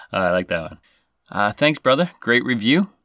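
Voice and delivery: normal talking voice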